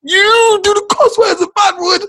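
high, slow, laughing disbelief; laughing protest. Retold or imitated. Son laughs back at his swearing mother. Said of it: "I'm like".

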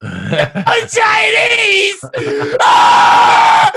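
high, drawn-out mock wail of despair; hammed-up tearful shriek; crying child's wail. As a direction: cry it out loud, ending in a scream. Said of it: "She cried".

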